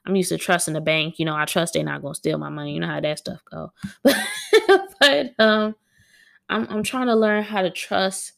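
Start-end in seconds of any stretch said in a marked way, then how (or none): 3.8-5.0 short, high burst of laughter; real laugh. Real, not acted. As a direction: break into a quick high giggle. Laughs at own aside.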